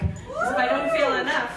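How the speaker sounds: winded, catching breath; breathless from the climb. Real, not acted. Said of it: "So I'm a little winded".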